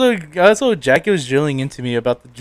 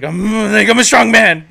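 loud, higher mock macho boast; ego moment; 5 semitones higher, 8 dB louder than usual. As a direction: puff up like a self-proclaimed strongman. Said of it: "like, an ego moment".

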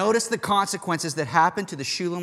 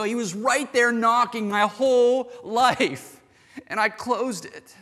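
a hint of anguished regret, pitched higher; lost soul's lament; 8 semitones higher, 4 dB louder than usual. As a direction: lightly voice someone who missed his chance. Voicing lost soul's eternal regret.

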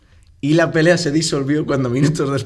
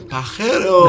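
higher yelled insult with a laugh in it; retold insulting yell; 6 semitones higher, 4 dB louder, much slower than usual. Friend yelling at a passing lad, retold as a laughing yell. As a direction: yell "Pajero" up high, half laughing. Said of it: "le gritó".

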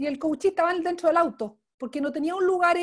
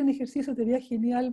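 normal talking voice

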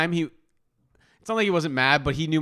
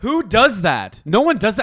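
loud, higher-pitched disbelief; indignant protest; 6 semitones higher, 5 dB louder than usual. Real, not acted. Denying a friend's teasing claim, indignant. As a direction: push back hard, pitch climbing.

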